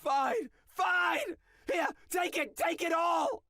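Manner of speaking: high, loud, desperate giving up; panicked surrender. Acted. Cornered cartoon hands over all money in panic. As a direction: shove the money out in terror.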